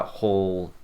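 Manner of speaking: ordinary talk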